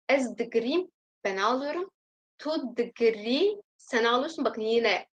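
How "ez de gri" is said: ordinary delivery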